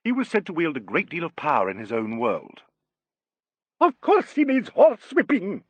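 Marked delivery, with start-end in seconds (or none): 3.8-5.6 high, loud diva outburst; shrieking prima donna; shouted. A narrator's reading. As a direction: shout it in imperious indignation. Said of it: "shouted his dear one".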